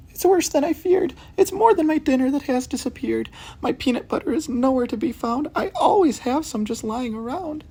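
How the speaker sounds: put-on very high, sleepy old cat voice; Missus Hudson's cat voice. Cat Missus Hudson tells detectives of her lost food, very high voice.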